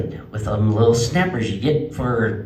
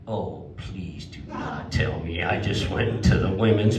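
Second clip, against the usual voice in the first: lower, slow dread; dreading realization; 10 semitones lower, 4 dB quieter than usual. Retold or imitated. Realizing he's in the women's restroom, lower, slow dread. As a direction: pray it isn't so.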